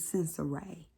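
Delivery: Sincere said sin-suh-RAY, softly; Sincere in three syllables, ending in RAY; corrected name pronunciation. Shown as a demonstration. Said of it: "her name".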